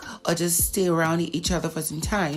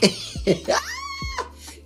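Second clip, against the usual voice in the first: long, very high squealed laugh; high-pitched laugh. Real, not acted. Vlogger reacts to the subtle shade, high laugh.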